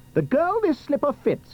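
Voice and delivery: high, proclaiming prince; ardent cry. Acted. Prince clutching the slipper, crying his vow. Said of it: "he cried".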